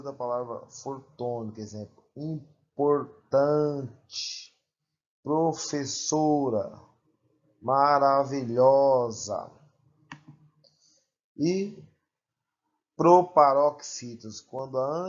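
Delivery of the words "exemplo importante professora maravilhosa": importante, professora, maravilhosa said slowly, each stressed on the second-to-last syllable; penultimate stress shown. Shown as a demonstration. Teacher showing students paroxytone words, slowly stressing each one. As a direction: slowly lean on tan, so and lho. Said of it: "Paroxítonas, quando a penúltima sílaba".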